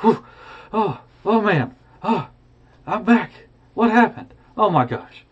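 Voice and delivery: high, breathless gasps of relief; a man just dropped back into his bed; shocked relief. Acted. Scrooge lands after falling.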